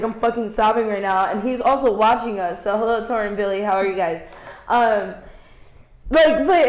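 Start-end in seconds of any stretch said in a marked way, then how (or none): none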